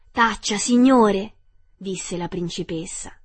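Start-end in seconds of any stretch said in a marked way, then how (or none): 0.1-1.3 loud, slow, higher reprimand; indignant rebuke. A narrator's reading. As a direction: silence him sternly, drawn out. Princess silences the rude clown.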